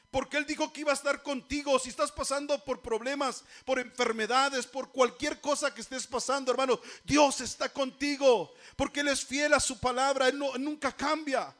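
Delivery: fervent, forceful preaching. Real, not acted. Preacher assuring the suffering that God is faithful, fervent preaching. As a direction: build to a loud declaration of faith.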